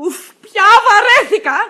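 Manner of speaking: slow, high, loud wail; fed-up outburst. Acted. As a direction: groan loudly that patience is gone. Wife fed up in quarrel. (αγανακτισμένο ξεφωνητό)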